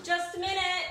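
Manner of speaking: high, loud call through a door. Retold or imitated. To the knocker outside the bathroom, a loud hurried call. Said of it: "And I'm like".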